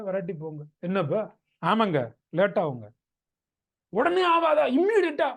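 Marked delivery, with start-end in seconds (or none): 3.9-5.3 high, loud incredulous demand; patient's pitched-up insistence, mimicked; imitating impatient patient. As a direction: push the question up in mock disbelief. Acts patient insisting on instant cure.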